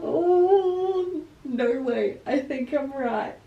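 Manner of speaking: overwhelmed she was right; real teary shock; on the verge of tears. Real, not acted. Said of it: "I'm gonna cry".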